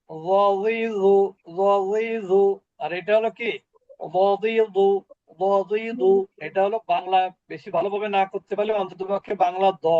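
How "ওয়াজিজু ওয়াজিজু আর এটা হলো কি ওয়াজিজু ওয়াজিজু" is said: ওয়াজিজু said slowly, tongue edge against the molars; Arabic dhad drill. Shown as a demonstration. Teacher modelling the dhad letter slowly for students. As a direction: stretch out ওয়াজিজু, slow and heavy. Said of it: "এটাও তাই".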